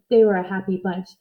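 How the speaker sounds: ordinary delivery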